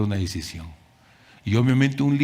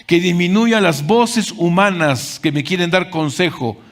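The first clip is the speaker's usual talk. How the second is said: louder and higher with each que disminuya; preaching at full force; 6 semitones higher, 4 dB louder than usual. Acted. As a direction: build the litany to a fervent peak. Preaching climax. (predicación en aumento, cada vez más fuerte)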